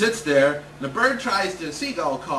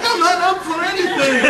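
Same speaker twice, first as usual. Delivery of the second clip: frantic protest; 11 semitones higher, 8 dB louder than usual. Retold or imitated. Kid panicking.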